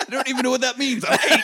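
put-on child at the Ouija board, high and indignant; little kid. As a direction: play an eight-year-old who doesn't get it. Playing a child confused.

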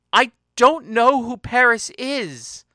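high, loud, slow insistence; anguished protest. Acted. She denies knowing Paris, loud anguished protest. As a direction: protest loudly, drawing the words out. Emphatic denial in a quarrel.

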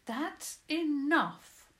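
high, loud, slow firm command. Acted. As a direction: put your foot down. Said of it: "spoke firmly".

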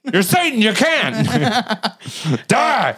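mock accusing shout. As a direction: shriek a hammed-up condemnation. Acted accusation, yelled.

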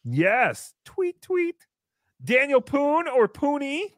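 ordinary talk, nothing marked

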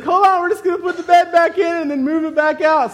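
put-on high, loud flustered old lady; nice old lady. Old lady instructing stuck MRI patient, high and loud. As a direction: imitate the old lady raising her voice. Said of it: "nice little old lady".

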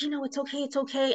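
soft, high soothing to a scared dog; quietly calming a trembling pit bull. Retold or imitated. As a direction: hush a shaking dog gently. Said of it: "And I said".

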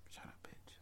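very quiet whisper; acted hushed insult. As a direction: whisper it under the breath. Character mutters an insult aside.

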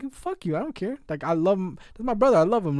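high, loud defiant retort; telling off someone who badmouths his friend. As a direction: snap back defending his brother. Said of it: "I'm like".

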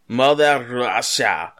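hammed-up Russian accent; mock Russian accent. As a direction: ham up a Russian boss saying it.

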